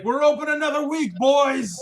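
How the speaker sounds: high, loud celebratory shout; bartender whooping. Acted. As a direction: yell it out to the whole crowd. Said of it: "And he's like".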